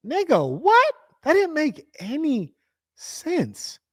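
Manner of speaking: can't believe what he just heard; high-pitched stunned disbelief. Real, not acted. Baffled by the clip.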